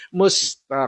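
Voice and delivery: "Mustaqim" with the last vowel held absurdly long; overlong imam recitation. Retold or imitated. Teacher mimicking imams in the mosque, overlong "Mustaqim". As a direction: stretch the end of "Mustaqim" far too long.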